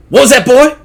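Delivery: sharp, loud, high demand; angry father. Acted. Jeffrey challenges his muttering son, loud and angry. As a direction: bark it at the sulking son.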